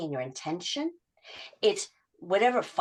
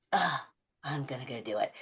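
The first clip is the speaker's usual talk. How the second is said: mock grudging, lower and softer, with a groan; 5 semitones lower, 5 dB quieter than usual. Coach imitating a client going reluctantly, grudging mock groan. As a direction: drag yourself through it with an ugh. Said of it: "I don't care if you do it like".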